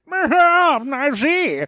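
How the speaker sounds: high, slow, hammy villain voice; sneering panto villain. Acted. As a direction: twirl the mustache and sneer. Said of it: "a portly Imperial guy who's just like".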